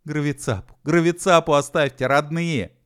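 high, loud begging; pleading. A narrator's reading. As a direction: implore them to leave it. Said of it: "взмолился Би".

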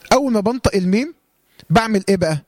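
ordinary talk, nothing marked

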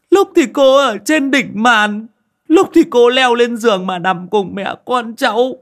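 high, loud wailing as the story pours out; blubbering complaint, pitched high; tearful, sobbing account. A narrator's reading. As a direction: cry it out like a terrified young mother. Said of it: "Chị nọ vừa khóc, mếu máo".

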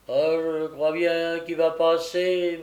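slow call in a lightly put-on voice; Brittles crying out. A narrator's reading. Brittles telling mademoiselle of the dying man, calling slowly. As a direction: call it out slowly, a touch of character voice. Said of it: "cria Brittles".

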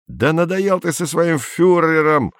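yelling. A narrator's reading. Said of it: "заорал на него Андрей".

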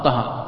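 quick, clipped طاها; طاها with both aa sounds shortened; cut short. Shown as a demonstration. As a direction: say طاها fast with no held vowels. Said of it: "بسرعة".

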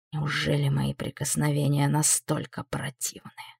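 low soft demon hiss. A narrator's reading. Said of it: "прошипел демон".

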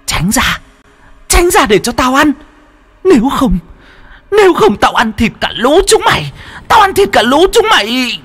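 very high, menacing possessed voice; possessed threat. A narrator's reading. Possessed wife threatens family blocking her food, high menacing voice. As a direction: threaten them as a ravenous demon. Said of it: "Nga trợn tròn mắt gầm gừ".

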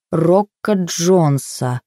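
ordinary delivery, nothing marked

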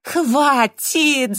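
loud, high cry to stop; desperate yell; anguished shout. A narrator's reading. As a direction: cry out, unable to bear it. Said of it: "закричал Джастин".

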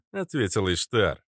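usual speaking voice

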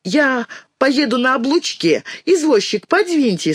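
put-on high, assertive child; little-girl voice. A narrator's reading. Dinka tells the cabman to move over, high child voice. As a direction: announce it in a high girlish voice.

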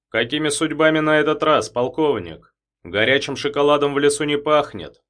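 mock them with a sneer; sarcastic. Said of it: "язвительно промолвил Забелин".

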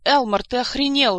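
loud outraged yell; high-pitched angry shout. A narrator's reading. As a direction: bellow in shocked outrage, pitch up. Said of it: "заорала Ольга".

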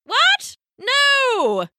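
high, drawn-out no; shocked cry. A narrator's reading. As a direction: cry out in horrified refusal. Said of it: "cried Pippo".

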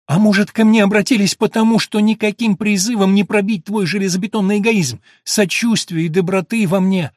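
high, loud, furious retort; angry outburst. Acted. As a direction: hurl it back angrily. Eleanor lashes out in quarrel.

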